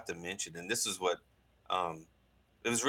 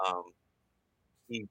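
normal talking voice

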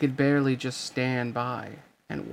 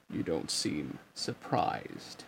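much lower, slow and softer villain voice; menacing deep villain; 10 semitones lower, 8 dB quieter, slower than usual. A narrator's reading. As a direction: purr it slowly, deep and cold. Villain Ansem taunts Riku.

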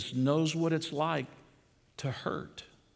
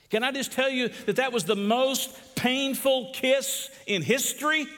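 high, ringing sermon cry; impassioned preaching; 9 semitones higher than usual. Acted. Pastor stressing the painful kiss, ringing preaching. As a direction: lift it up as the climax.

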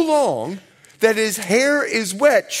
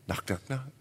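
very soft, low knock-knock; 8 semitones lower, 11 dB quieter than usual. Retold or imitated. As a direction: say it like gentle taps at night. Acting the knock softly.

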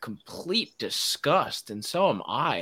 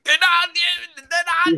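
much higher and louder, a hammed-up pundit yell; Stephen A cadence; 8 dB louder than usual. Mocking Stephen A's brash on-air cadence, hammed-up yell. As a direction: blast it like an over-the-top TV pundit. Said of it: "like, this cadence".

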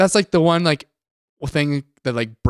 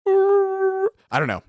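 drawn out high mock; much slower than usual. Mock mute hero reply.

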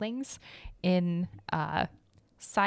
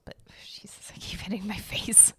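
whispering; 7 dB quieter than usual. Real, not acted. Aside after hitting her face.

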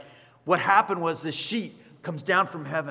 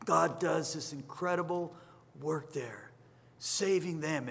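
soft, half whispered; hushed awe; 7 dB quieter, slower than usual. Real, not acted. Preacher describing the Spirit falling, hushed. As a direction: hush it as if sacred.

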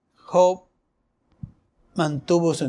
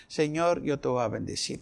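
softer, lower humble vow; humble blessing; 3 semitones lower, 7 dB quieter than usual. Shown as a demonstration. Preacher contrasting complaint with soft humble blessing. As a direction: bless God quietly, surrendered. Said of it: "En lugar de decir".

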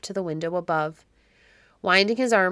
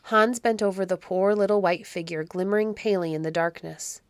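plain reading voice

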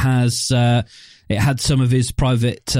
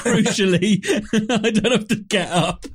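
laughing; 7 semitones higher than usual. Real, not acted. Echoing a funny line.

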